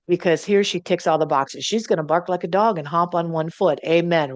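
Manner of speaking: normal talking voice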